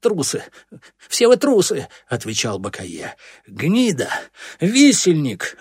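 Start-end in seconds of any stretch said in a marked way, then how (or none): 0.0-1.9 high cry, rising to a loud shout; defiant insult. A narrator's reading. Cornered boy hurls insults back. 3.5-5.6 high, loud insult, building on the second word; roaring. A narrator's reading. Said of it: "взревел Курносый".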